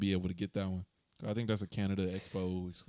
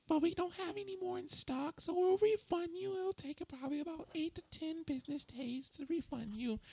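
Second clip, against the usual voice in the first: high nasal customer service voice; whiny, over-the-top service rep; obnoxious voice. Pretend. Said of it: "trying to find the most obnoxious voice".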